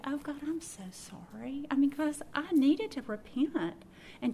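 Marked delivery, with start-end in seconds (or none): 0.0-1.7 soft whispered confession; whispered apology. Retold or imitated. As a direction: whisper it, contrite. Repenting to God.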